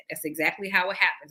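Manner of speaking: ordinary talk, nothing marked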